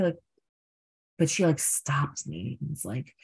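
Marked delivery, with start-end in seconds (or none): none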